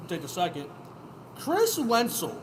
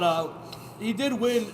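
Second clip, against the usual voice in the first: ordinary talk, nothing marked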